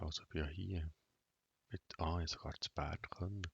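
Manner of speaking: ordinary talk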